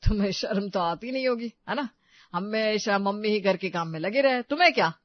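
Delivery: loud acted scolding; accusing husband. Husband shaming wife over housework, loud accusation.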